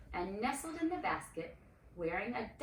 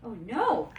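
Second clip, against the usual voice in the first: louder sudden outcry; cried out; 3 semitones higher, 11 dB louder than usual. A narrator's reading. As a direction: blurt it out in alarm. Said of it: "Miriam cried".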